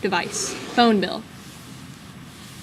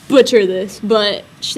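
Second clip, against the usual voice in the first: ordinary delivery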